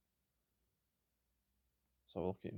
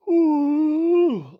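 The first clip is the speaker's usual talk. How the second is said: loud, high-pitched drawn-out yawn; tired yawn; 17 dB louder than usual. Real, not acted. Gamer tired from night shifts, yawning loudly. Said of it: "I'm tired".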